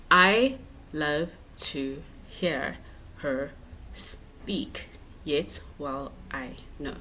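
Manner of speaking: "I love to hear her speak yet well I know" slowly, each syllable apart; counting syllables. Shown as a demonstration. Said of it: "let's count them".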